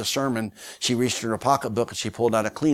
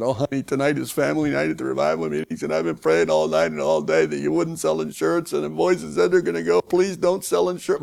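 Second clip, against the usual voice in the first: wife's voice pitched well higher, pleading; 6 semitones higher than usual. Retold or imitated. Weeping wife begs her husband to come to the revival, higher pleading voice. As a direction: beg him not to go to work tonight.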